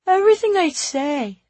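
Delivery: put-on high, loud small-kid voice; high-pitched little voice from the next room; little child's voice. A narrator's reading. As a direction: play a little kid complaining. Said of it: "Timby's little voice".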